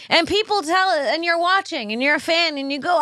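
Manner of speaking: ordinary talk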